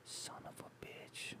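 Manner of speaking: breathy under-the-breath whisper. Real, not acted. Cursing at the map app in a whisper. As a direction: hiss it under your breath, almost silent. Swearing at Google Maps.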